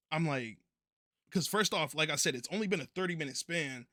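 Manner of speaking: ordinary talk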